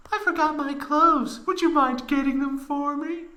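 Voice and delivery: put-on very high girl voice asking; Tifa falsetto. Tifa asking Cloud for her clothes, high falsetto. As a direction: ask sweetly in falsetto.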